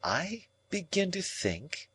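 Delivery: soft, slow musing. A narrator's reading. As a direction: think aloud quietly. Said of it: "said Estella in a musing way".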